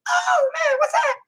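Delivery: loud cartoon falsetto squeal; kicked-in-the-balls voice. Shown as a demonstration. Cartoon man kicked in the balls, squealing in falsetto. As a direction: yelp it way up high. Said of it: "kicked in the balls and the voice changes".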